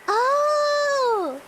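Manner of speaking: high, startled oh; shocked oh. Real, not acted. Shocked at chat's question.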